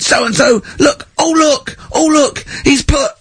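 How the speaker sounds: high crying wail; half sob, half shout; teacher's wail. Retold or imitated. Teacher wailing over a pupil's graffiti, high crying shout. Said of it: "he went".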